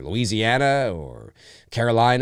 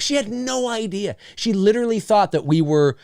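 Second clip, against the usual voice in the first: normal talking voice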